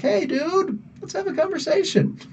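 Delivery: mock casual invite. Imagining pitching a chat to Al Ewing, high mock-casual voice. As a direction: play it as a breezy, over-eager hello. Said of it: "be like".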